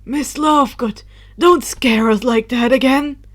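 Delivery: put-on loud scolding old wizard voice; stern exclaim. Dumbledore scolding Luna for wandering off, loud stern voice. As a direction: scold her loudly, relieved and cross. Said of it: "Dumbledore exclaimed".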